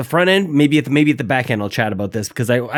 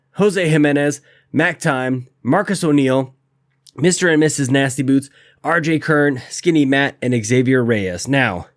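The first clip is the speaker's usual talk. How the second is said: usual speaking voice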